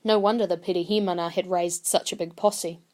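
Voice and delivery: ordinary delivery, nothing marked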